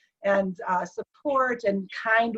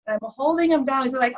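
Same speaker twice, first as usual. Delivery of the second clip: ordinary talk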